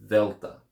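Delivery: Delta begun with a voiced th as in this, THEL-tah; soft th Delta. Shown as a demonstration. Teacher modelling Delta with voiced th. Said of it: "that soft the sound as in this".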